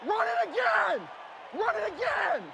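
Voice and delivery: high, slow, shouted demand; shouting to teammates. Real, not acted. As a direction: bark it at the huddle. Player urging to repeat play.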